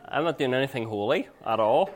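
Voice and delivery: ordinary talk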